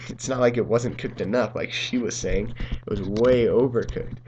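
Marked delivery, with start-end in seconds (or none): none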